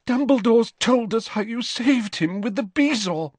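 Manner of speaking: high tearful gush; sobbing. A narrator's reading. Mrs Weasley hugs Harry, high and tearful. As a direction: sob it out to Harry. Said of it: "she sobbed".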